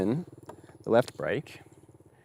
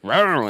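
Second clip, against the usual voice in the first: mock engine roar; 7 semitones higher, 10 dB louder than usual. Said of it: "twist this thing and be like".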